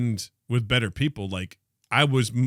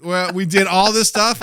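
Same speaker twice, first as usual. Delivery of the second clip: fired-up; 7 semitones higher, 6 dB louder than usual. Retold or imitated. Retold resolve.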